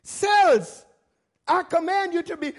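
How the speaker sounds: forceful preacher's command; bold command. Shown as a demonstration. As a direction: order the cells with authority. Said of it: "speak it".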